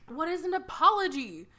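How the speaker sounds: high puzzled alien. Retold or imitated. Alien buddies telling Dean, high baffled voice. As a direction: sound lost in our world.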